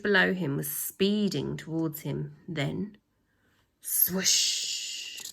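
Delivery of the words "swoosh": breathy whispered swoosh; a hushed swooping sound. Acted. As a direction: breathe it out like rushing wind.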